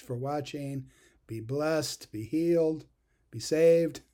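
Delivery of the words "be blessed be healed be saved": rising blessing. Real, not acted. Closing blessing building up.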